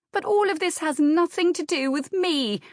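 high-pitched, self-pitying wail. A narrator's reading. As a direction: wail it like an aggrieved sister. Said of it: "wailed Anne".

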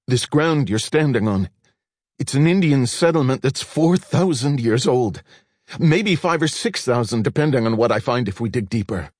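desperate to convince him; excited pleading. A narrator's reading.

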